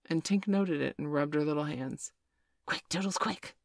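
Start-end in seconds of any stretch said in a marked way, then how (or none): none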